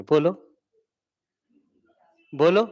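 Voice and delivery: ordinary delivery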